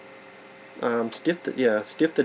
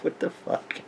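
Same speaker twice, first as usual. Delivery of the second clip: breathy stunned whisper; whispering what the fuck in shock; whispered disbelief; 3 semitones higher, 11 dB quieter than usual. Retold or imitated. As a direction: hiss it under your breath in disbelief. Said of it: "I'm like".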